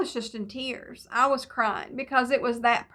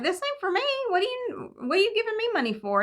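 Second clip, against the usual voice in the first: high-pitched, incredulous retold questioning; baffled disbelief; 10 semitones higher, faster than usual. Woman asks neighbor why he gives her money, baffled.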